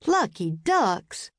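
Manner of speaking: very slow, drawn-out Southern drawl; Southern accent. A narrator's reading. Benny cuts in on Jack, slow friendly Southern accent. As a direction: stretch it out like a friendly Georgia guard. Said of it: "He spoke with a Southern accent".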